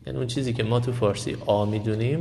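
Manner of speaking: normal talking voice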